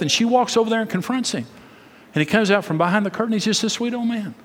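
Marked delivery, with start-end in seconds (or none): none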